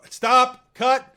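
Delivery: high, loud shout to halt the take; shouted stop. Retold or imitated. Director on set stopping the newcomer's first take with a loud yell.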